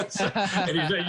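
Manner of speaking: laughing. Real, not acted. Laughing right after the punchline.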